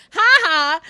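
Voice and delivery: high, loud "ha ha"; mock triumphant laugh. Streamer teasing friend over memory, mock laugh. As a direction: belt out a gloating fake laugh. Gloating burst amid teasing banter.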